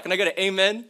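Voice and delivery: rally the room like a joking preacher; mock preacher call. Calls on the audience, preacher style.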